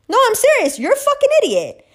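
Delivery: very high-pitched exasperated outburst; indignant insistence. Real, not acted.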